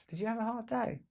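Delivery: mock-caring sarcastic question. Shown as a demonstration. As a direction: ask it with a sarcastic edge.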